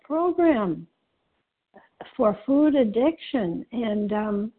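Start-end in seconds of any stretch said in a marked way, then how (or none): none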